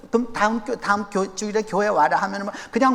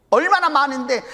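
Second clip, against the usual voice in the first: ordinary delivery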